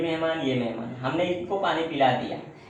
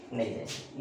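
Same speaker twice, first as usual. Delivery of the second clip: dropped lower and softer; answering himself; 4 semitones lower, 8 dB quieter than usual. Shown as a demonstration. Teacher answering his own question, lower and softer. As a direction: answer your own question low and soft. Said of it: "देखो तो one जाएगा divide?".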